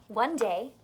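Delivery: ordinary delivery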